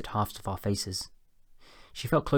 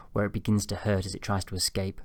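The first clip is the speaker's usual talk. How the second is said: ordinary delivery, nothing marked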